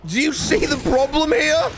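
high, slow pleading complaint; exasperated. Real, not acted.

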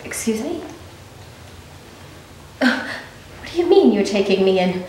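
ordinary talk, nothing marked

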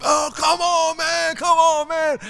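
very high, loud imitation of fed-up kids; kids yelling. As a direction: yell it in a high kid voice. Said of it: "They start yelling".

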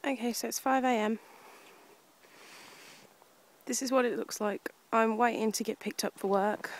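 hushed so as not to wake anyone; quiet early morning voice. Real, not acted. Quiet early-morning whispered vlog.

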